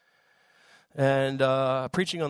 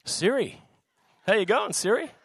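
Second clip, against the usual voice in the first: higher-pitched, jokey hail to Siri; raised, chummy call; playful calling out; 6 semitones higher, 4 dB louder than usual. Real, not acted. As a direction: greet the phone assistant in a lifted, joking voice. Playfully addresses phone assistant Siri.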